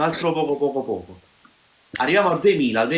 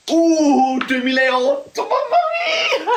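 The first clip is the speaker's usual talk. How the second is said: excited shout; 11 semitones higher than usual. Real, not acted. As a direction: shout for joy, laughing. Cheering the count reaching 2008.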